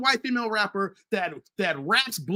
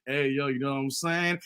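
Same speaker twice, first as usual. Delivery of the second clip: a hint of laid-back street talk, pitched lower; slang tone; 7 semitones lower, 4 dB quieter than usual. Host imitates switching tone around another culture, lightly voiced slang. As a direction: lightly put on a low slang voice. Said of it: "they start turning the tone and how they talk".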